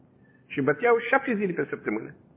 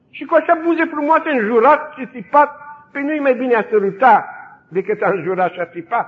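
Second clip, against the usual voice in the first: higher and louder, pressing her point; earnest plea; 7 semitones higher, 5 dB louder than usual. Retold or imitated. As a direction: plead loudly with the captain. Girl pleads with the interrogator. (rugătoare, mai tare și mai sus)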